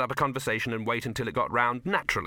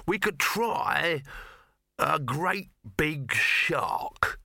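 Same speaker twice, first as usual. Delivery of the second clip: slow, raised, groping suggestion; slightly surprised; 6 semitones higher, much slower than usual. A narrator's reading. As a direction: draw it out, surprising yourself. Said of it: "Dibbler sounded slightly surprised at his own suggestion".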